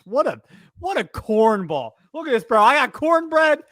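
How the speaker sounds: high, loud, slow teasing; mock cornball rant. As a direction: ham up the mockery. Mocking a corny agent loudly.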